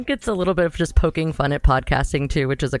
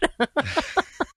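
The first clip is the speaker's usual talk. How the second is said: high, soft laugh; 11 semitones higher, 4 dB quieter than usual. Real, not acted. Podcaster amused by wanting a cat, high laughing. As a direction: crack up after your own remark.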